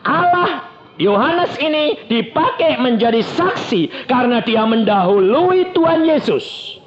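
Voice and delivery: high, emphatic exhortation; full-force preaching. Real, not acted. Preacher urging the congregation to read Scripture, full-force preaching. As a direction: drive each point home from the pulpit.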